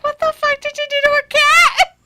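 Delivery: incredulous outburst still cracking up; laughing disbelief. Retold or imitated. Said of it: "I'm like".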